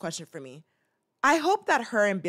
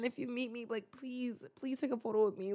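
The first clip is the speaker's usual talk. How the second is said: much softer, begging plea; pleading; 3 semitones higher, 11 dB quieter than usual. Real, not acted. Asking Sebastian Stan for a photo, a hushed plea. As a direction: plead softly with him. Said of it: "begging Sebastian".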